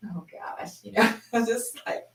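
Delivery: breathless, voiceless giggle; laughing. Retold or imitated. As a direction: giggle helplessly through the words. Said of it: "I'm just laughing".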